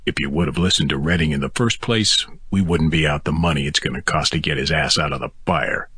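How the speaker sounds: usual speaking voice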